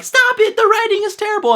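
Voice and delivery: high, loud whiny mock protest; mock heroine voice. Reviewer mocking the heroine's whiny protest, pitched high and loud. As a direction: squeal it like the put-upon girl. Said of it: "and she's like".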